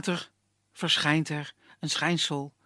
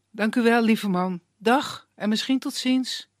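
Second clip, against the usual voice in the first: high, bright thanks; grateful young woman; 7 semitones higher, 5 dB louder than usual. Acted. Girl thanking the woodsman as she leaves, high voice. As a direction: thank him warmly.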